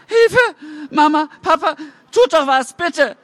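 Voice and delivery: put-on high, loud, panicked boy; dragged boy crying out to his parents; desperate cry for help. A narrator's reading. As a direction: beg mama and papa to act.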